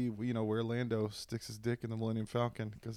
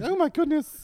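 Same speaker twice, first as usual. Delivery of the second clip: much higher, louder prissy droid; C-3PO impression; 11 dB louder than usual. C-3PO reacting to everything, high fussy squeal. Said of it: "he's like".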